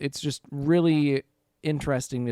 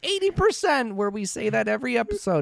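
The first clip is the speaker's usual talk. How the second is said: much higher, giddy mock excitement; mock wide-eyed wonder; 9 semitones higher, 3 dB louder than usual. Fan mimicking the early show's innocence, high giddy mock wonder. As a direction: gush like a kid discovering superpowers.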